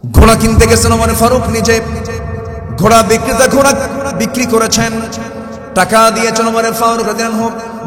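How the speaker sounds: high, loud fervent preaching; preaching at full force. Acted. Preacher urging the faithful, fervent shout. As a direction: preach it out to the crowd with fire.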